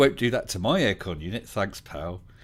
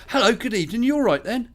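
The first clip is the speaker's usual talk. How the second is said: imitating a cheery teenage biker, much higher and louder; friendly young lad; 7 dB louder than usual. Storyteller imitates the biker greeting strangers, bright and loud. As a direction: chirp the greeting up high like a polite local lad. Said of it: "he turned to us and said".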